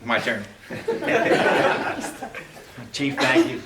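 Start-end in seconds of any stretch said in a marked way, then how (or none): 0.7-2.9 a short breathy laugh; light laugh. Real, not acted. Retiring officer laughs at the podium, breathy laugh. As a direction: laugh easily before your turn.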